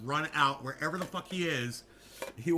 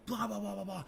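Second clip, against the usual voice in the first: high, soft rattled-off blahs; higher, lightly mimicked rant; mock ranting babble; 5 semitones higher, 6 dB quieter than usual. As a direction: gabble it as a stand-in for a tirade.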